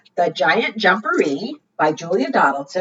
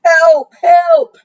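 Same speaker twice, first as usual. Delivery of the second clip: loud, higher bellow for help; bellowed cry; 9 semitones higher, 8 dB louder than usual. Acted. Scared Bear bellows for help at the burrow, loud and high. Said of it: "bellowed Bear".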